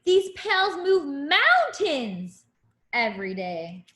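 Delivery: sing-song picture book rhyme; reading to a child. Acted. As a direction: read it like a bedtime rhyme.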